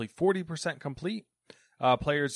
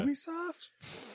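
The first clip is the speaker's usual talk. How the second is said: incredulous; 6 dB quieter, much slower than usual. Real, not acted. Mock incredulous question.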